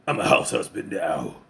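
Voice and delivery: low, slow, soft yakuza voice; Tatsu's deep voice. Retold or imitated. Ex-boss to his old henchman, low and slow.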